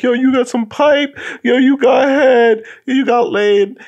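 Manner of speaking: high, loud taunting laugh-voice; mocking friend. Driver friend to crying boy, high mocking taunt. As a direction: tease him, cackling high. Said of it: "he's laughing at him like".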